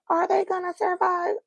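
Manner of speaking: very high-pitched mock panic; imitated frantic family. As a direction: squeal it like an anxious relative, acted out. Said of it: "the family is like".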